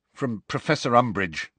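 ordinary delivery

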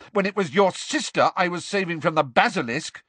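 high, heated accusing voice; indignant, high-pitched rant; angry accusation. A narrator's reading. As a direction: throw it at your friend. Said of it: "he rounded on Ron".

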